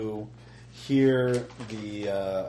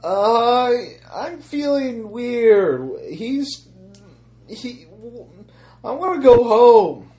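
put-on scared child voice; 13 dB louder, much slower than usual.

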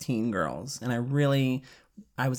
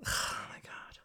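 breathy sighing whisper; 14 dB quieter than usual. Real, not acted. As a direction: sigh it out under your breath.

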